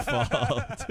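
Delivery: ordinary talk, nothing marked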